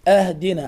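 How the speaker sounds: "أهدنا" said ahdina; fatha on alif. Shown as a demonstration. Teacher mimics faulty readers, initial a in "أهدنا". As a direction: put an a at the start of "أهدنا". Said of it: "بعضهم يقرأ هكذا".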